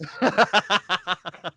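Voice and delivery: loud, high burst of laughter; hearty laugh. Real, not acted. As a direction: laugh out loud at a friend's teasing. Reacts to host's teasing joke.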